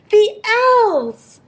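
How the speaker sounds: high, loud exclamation; excited shout. A narrator's reading. Children spotting Santa's elves from the train, excited shout. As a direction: cry out, high and thrilled. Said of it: "shouted the children".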